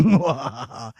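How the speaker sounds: low villain chuckle; evil laugh. Acted. Wizard laughing at the princess. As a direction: cackle with glee.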